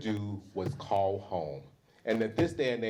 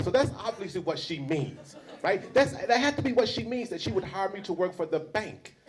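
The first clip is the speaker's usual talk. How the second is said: higher, bright self-persuading inner voice with a laugh; convincing myself; 6 semitones higher than usual. Retold or imitated. Student at hostess's house, telling herself it's about the bank, rising and eager. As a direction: talk yourself into it, pitch climbing.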